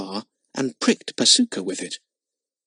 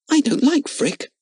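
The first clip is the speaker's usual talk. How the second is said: obstinate. Acted. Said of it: "said Lotta obstinately".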